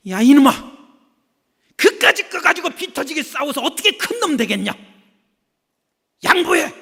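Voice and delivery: put-on loud, stern scolding of the older boy; scolding parent. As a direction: yell at the big brother to give in.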